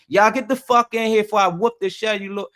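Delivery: energetic angry order. Retold or imitated. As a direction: imitate a fed-up parent ordering kids in. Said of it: "It's the energy".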